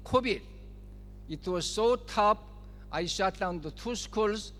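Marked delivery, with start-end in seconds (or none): none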